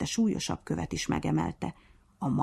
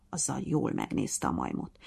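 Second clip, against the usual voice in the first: ordinary delivery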